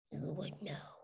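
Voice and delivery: soft, low grumble under the breath; muttered. A narrator's reading. Chuck grumbling at the robot, low mutter. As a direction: mutter it sourly to yourself. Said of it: "Chuck muttered".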